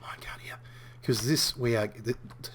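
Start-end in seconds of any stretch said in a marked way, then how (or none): 0.0-0.6 sneaky whisper. Real, not acted. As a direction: whisper it like stealing something. Sneaking off with a minecart.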